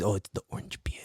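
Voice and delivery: low, breath-only whisper; hushed, conspiratorial reveal; secretive whisper. Acted. Uncle revealing a secret ingredient.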